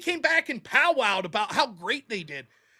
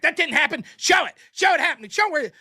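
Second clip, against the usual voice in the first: loud, higher mocking demand for proof; mock angry demand; 4 semitones higher, 7 dB louder than usual. Imitating a debater loudly demanding proof. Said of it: "And they'll be like".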